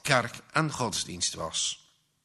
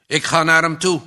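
a loud, higher, hot-headed declaration; much louder, fired-up statement; angry vow; 4 semitones higher, 9 dB louder, faster than usual. Acted. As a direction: announce it like a threat. Arjaan vows to confront Gert-Jan.

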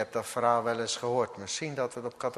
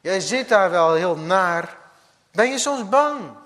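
Mohammed taunting; 5 semitones higher, 8 dB louder than usual. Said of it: "vervolgens kwam Mohammed langs".

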